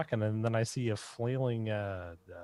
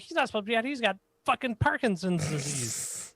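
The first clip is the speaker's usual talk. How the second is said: put-on yelling old woman; 10 semitones higher than usual. Old woman scolding the passer-by about her husband, high exasperated yell. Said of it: "while she's yelling at me".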